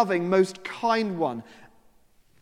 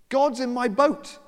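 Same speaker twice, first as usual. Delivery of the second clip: higher, slow, amazed exclaim; astonished realization; 8 semitones higher, 4 dB louder, slower than usual. Acted. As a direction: let the wonder break through.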